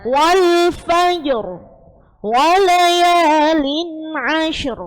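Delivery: slow drawn-out Arabic recitation of wal fajr; Quranic recitation. Shown as a demonstration. Teacher modelling a Quran verse for students, slow careful recitation. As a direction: recite it slowly, letter by letter. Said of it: "Kemudian yang kedua".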